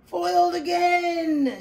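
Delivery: high, slow cartoon villain shout; germ shouting. Headache germ shouting as it swirls down the drain. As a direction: bellow it like a defeated villain. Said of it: "shouted the germ".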